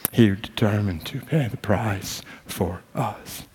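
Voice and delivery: slow, hushed, reverent delivery; solemn preaching. Acted. Preacher to church on being redeemed, solemn and hushed.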